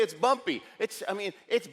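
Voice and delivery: ordinary talk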